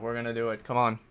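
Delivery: ordinary talk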